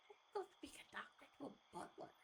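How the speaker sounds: fast whispered wondering; whispered aside. Real, not acted. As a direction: whisper it quickly to yourself. Player's muttered aside.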